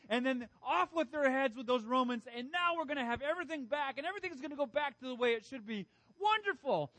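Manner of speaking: high, fast, loud gloating cheer; gleeful crowd. Retold or imitated. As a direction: imitate a crowd dreaming of revenge. Voicing crowd's hopes.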